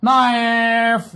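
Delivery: نايف said very slowly, drawn out; teaching the word. Shown as a demonstration. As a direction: stretch نايف long and clear. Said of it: "سكينة يعني ايه".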